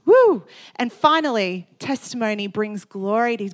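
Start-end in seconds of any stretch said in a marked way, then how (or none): 0.0-0.4 high loud whoop; real excited woo; cheer. Real, not acted. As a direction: let out a joyful whoop. Preacher cheers at climax.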